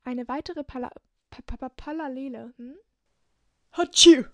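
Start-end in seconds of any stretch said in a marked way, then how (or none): 3.7-4.2 loud sudden sneeze; big explosive sneeze; sneezing. Real, not acted. Outtakes full of sneezes.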